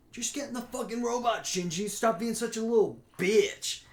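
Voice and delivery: high, sneering mock rant; mocking bossy fan. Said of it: "when people are like".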